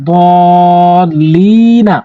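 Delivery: দল লি না with দল drawn out very slowly; slow chanted recitation; long madd stretch. Shown as a demonstration. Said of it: "অবশ্যই ধরার আগে টানবেন".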